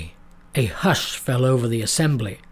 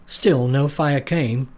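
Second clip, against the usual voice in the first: usual speaking voice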